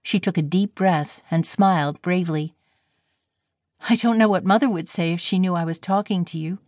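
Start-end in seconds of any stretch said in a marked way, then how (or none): none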